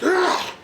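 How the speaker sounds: high loud effort grunt; punching grunt. Retold or imitated. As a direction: throw the punch with a cry. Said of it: "he's like".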